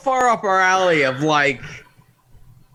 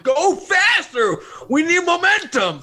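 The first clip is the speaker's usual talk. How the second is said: retold urgent shout; 8 semitones higher than usual. Passenger urging the driver through sand, high mock yell.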